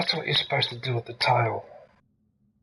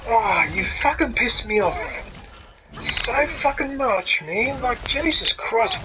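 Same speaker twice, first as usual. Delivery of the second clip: higher, exasperated swearing; exasperated rant; 7 semitones higher than usual. Real, not acted. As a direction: vent it all in disbelief. Raging at enemy, high pitched.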